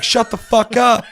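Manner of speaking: high, loud, slow shout; hammed-up shouted rage; mock furious yell. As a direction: scream at him to shut up. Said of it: "I'm like".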